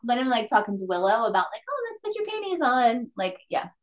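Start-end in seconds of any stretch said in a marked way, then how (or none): 1.7-3.1 sing-song mom voice. Retold or imitated. Mom talking to child Willow.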